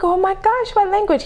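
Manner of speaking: high, eager question; excited curiosity. Retold or imitated. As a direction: gush with amazed interest. Retold excited question to manager.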